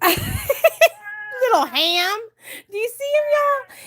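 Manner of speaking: slow, high, laughing through the words; tickled, laughing mom; laughing fondly. Real, not acted. Laughing at son's old video.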